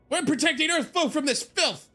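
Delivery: high ranting youth; yelling kid. Acted. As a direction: shout it like a zealous brat.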